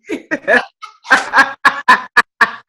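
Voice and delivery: long, loud cackle; big real belly laugh; helpless laughter. Real, not acted. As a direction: keep laughing hard, out of control. Cracking up at herself.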